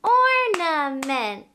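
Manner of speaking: slow, loud Ornament in three beats; slow loud syllable-by-syllable Ornament; clapped syllables. Shown as a demonstration. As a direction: clap out each syllable as you say it. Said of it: "Let's clap it".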